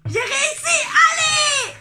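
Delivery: high, loud victory cry with laughter; triumphant shout. Real, not acted. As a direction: cheer like you just won. Triumphs after making her laugh.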